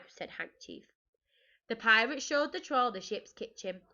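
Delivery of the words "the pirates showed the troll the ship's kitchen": ordinary delivery, nothing marked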